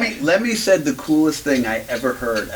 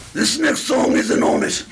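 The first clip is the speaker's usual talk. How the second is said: [name] impression; 12 semitones higher than usual. [name]'s punchline at the concert.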